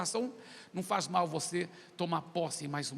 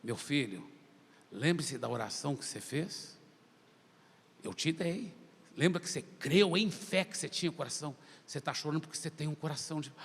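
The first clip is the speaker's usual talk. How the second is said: low, softened fatherly voice; gentle Holy Spirit; 5 semitones lower, 4 dB quieter than usual. Retold or imitated. Holy Spirit reminding his son of the prayer, gentle. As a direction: speak low and tenderly, as to a son. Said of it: "o Espírito Santo falou assim".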